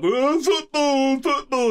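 high, drawn-out, hammed-up gushing over football; mock football fan. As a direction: parody your own gushing about the game, very slowly. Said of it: "you guys always make fun of me".

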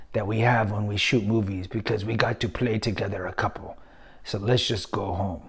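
low, hushed, sexy voice; Puss in Boots. Retold or imitated. Host quotes actor on his on-set romance, sexy low voice. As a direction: attempt a sultry, soft and low impression. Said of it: "my sexy Puss in Boots voice".

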